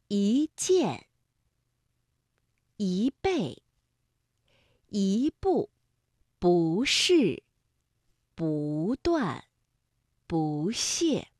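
一 and 不 rising before a falling syllable; word list 一件 to 不屑, each rise then fall; second-tone sandhi. Shown as a demonstration. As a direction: lift 一 and 不, then drop 件, 倍, 步, 是, 断, 屑. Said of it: "把这俩字呢变成二声".

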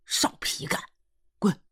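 terse annoyed dialect rebuff; short, exasperated dismissal; fed-up retort. A narrator's reading. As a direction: order them off, patience gone. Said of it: "他舅终于忍不住".

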